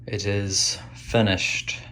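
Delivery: ordinary talk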